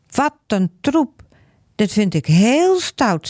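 high, stern scolding mother voice; angry mother. A narrator's reading. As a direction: scold the children like a cross mum. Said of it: "Ik ben heel boos". (boze moeder, streng en hoog)

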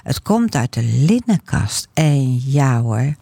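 ordinary delivery, nothing marked

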